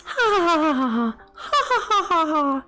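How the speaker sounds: fake laugh. Mock laugh after own pun.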